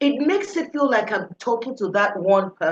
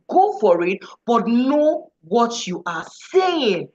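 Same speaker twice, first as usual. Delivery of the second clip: usual speaking voice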